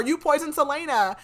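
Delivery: loud, high mock accusation; feigned shock. Storyteller voicing the Duke pretending he knew nothing, feigned shock. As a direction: act appalled, loud and hammed-up. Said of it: "he acts like he had nothing to do with it".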